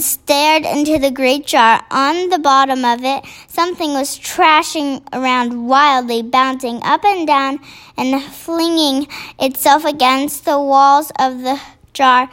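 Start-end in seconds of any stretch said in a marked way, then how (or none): none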